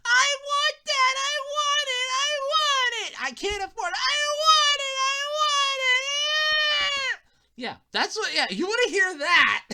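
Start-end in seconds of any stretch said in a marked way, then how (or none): 0.0-7.2 very high, loud begging whine; whining kid. Retold or imitated. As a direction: beg like a kid in the toy aisle. Kid begging in toy aisle.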